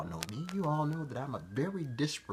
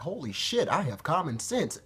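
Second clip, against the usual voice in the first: surprised; 5 semitones higher than usual. Retold or imitated. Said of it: "I'm surprised".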